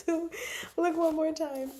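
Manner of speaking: high, giggly words through a laugh; giggling. Real, not acted. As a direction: laugh lightly as you say it.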